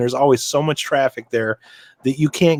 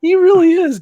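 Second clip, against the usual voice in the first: squealed agreement; 6 dB louder than usual. Real, not acted. Laughing agreement, pitch spike.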